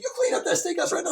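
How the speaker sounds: high, loud mock order; hammed-up mother scolding; scolding mom. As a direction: order her about like a strict mother. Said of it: "She's like".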